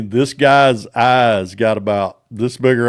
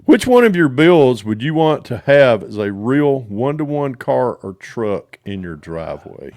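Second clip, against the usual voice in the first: usual speaking voice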